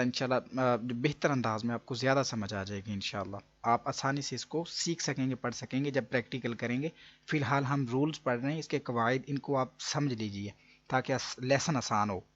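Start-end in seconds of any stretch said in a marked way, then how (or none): none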